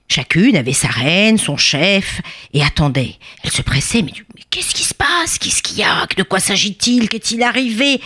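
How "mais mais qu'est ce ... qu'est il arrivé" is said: hurried whisper of a worried crowd; anxious whispering. Acted. Bees wondering why they were summoned, anxious whispering. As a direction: whisper the questions among the swarm. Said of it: "Elles étaient inquiètes".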